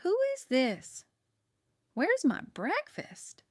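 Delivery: put-on high, swooping elderly demands; cranky confused aunt. A narrator's reading. Aunt quizzing her niece by phone, cranky. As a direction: demand it like a muddled old relative.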